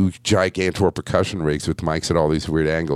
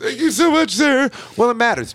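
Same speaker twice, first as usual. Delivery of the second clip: much higher and louder, playful echo; mock gush; 4 dB louder than usual. Host teasingly echoes the guest's thanks, in a high squeaky mock gush. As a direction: parrot the thanks in a squeaky tease.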